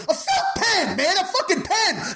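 cracking high, losing it; imitating a panicked actor cracking; voice breaking. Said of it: "his voice breaks just like that".

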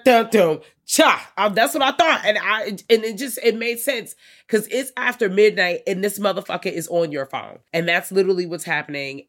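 loud and high, worked up; excited. Real, not acted. Hyping a horror film to co-host, excited and loud. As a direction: rattle it off all wound up.

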